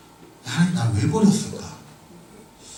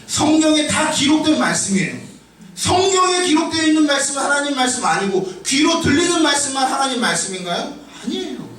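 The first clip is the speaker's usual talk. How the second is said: raised, pressing sermon voice with shouted peaks; fervent preaching; 10 semitones higher than usual. Acted. Pastor exhorting the congregation at full force. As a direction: drive the point home to the pews.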